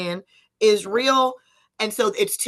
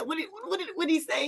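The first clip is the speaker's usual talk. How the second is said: usual speaking voice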